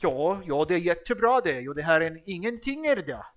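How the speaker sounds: lilting Swedish mimicry; mock Swedish. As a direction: parody how Swedes chatter. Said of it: "That's fucking Swedish".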